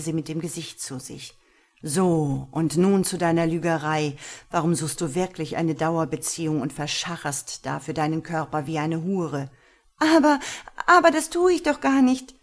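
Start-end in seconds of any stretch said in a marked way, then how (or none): 1.8-9.5 loud, hard accusing voice; stern interrogating master. Acted. 10.0-12.3 high, loud stammering denial; half-hearted protest. Acted. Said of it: "protestierte sie halbherzig".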